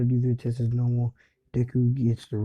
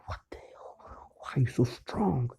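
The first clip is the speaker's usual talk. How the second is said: stunned whisper; 6 dB quieter than usual. Retold or imitated. Beaten rival, stunned.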